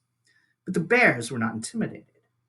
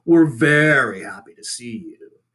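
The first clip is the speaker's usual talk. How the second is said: louder, mock-sweet sarcastic bear; dripping sarcasm; 7 dB louder than usual. A narrator's reading. Sister bear mocking the robot, sarcastic. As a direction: lay the false welcome on thick. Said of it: "her voice dripping with sarcasm".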